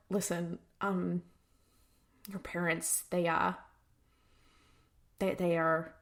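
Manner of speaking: very soft, halting retold quote; hesitant bad news. Sam telling Anna about her parents, soft and hesitant. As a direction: break it gently, stumbling softly.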